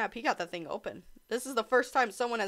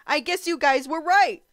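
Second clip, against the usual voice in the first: loud concession; 6 semitones higher, 12 dB louder than usual. Real, not acted. Conceding to chat again.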